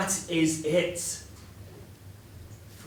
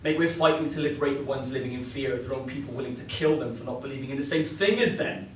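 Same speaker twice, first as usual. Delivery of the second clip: ordinary talk, nothing marked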